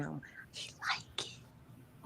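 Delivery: slow, soft whisper. Real, not acted. Host confiding to her co-hosts about her husband in a slow whisper. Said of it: "why am I whispering?".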